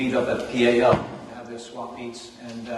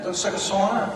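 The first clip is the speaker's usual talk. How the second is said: New York accent; 6 semitones higher than usual. Shown as a demonstration. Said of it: "With, with my New York accent".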